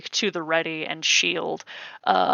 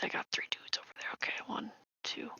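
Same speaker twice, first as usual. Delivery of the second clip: whisper; 3 semitones higher, 16 dB quieter, much faster than usual. Real, not acted.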